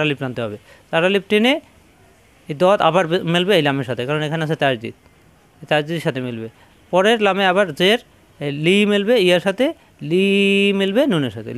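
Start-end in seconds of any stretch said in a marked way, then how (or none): none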